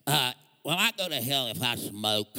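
low raspy smoker voice, mocking; smoker's voice. Pastor mimics smoking believers asking about hell, low raspy imitation. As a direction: croak it like a heavy smoker. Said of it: "That's how smokers talk".

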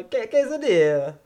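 high, slow mock Mexican Spanish accent on quesadilla. Showing friends his perfected Mexican accent, hammed up. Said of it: "Mexican accent that you perfected".

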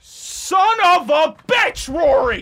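slow furious curse, shouted; angry shout. Acted. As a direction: blow up at your cohost. Discovers no radio station.

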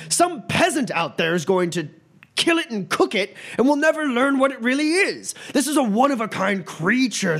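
high, loud, ranting academic; yelling professor. Acted. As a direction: berate the dean to get his way. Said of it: "a very, very loud voice kinda yelling".

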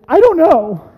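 high, loud panicked outburst; freaked-out yell. Retold or imitated. Lost hiker answers Becca's question, freaked-out yell. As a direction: shout it in a sudden panic. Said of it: "And I freaked out".

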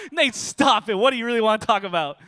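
mock-laughing girlfriend brushing off the news. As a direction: laugh it off like it's a joke. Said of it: "She starts laughing".

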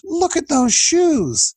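high, slow teasing stage voice; imitated speaker's exclamation. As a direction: pitch it way up and stretch it like a showman on stage. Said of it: "he goes".

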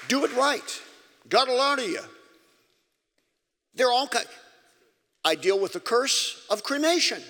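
high, loud pulpit preaching; preaching at full force. Acted. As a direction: preach it hard to the congregation.

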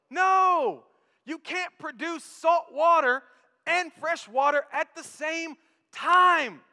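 emphatic preacher calling out answers; preaching at full force. Acted. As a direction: thunder the answer no to the church. Preaching climax.

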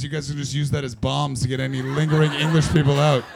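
ordinary talk

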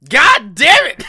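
loud exasperated yell, voice cracking; frustrated shout. Real, not acted. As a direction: bellow it in real frustration. Lost the guessing round, outburst.

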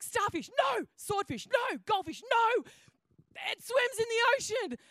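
high, loud exasperated rejections; frustrated guessing game. Retold or imitated. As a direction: shoot down each wrong guess. Frustrated clue-giver rejecting guesses.

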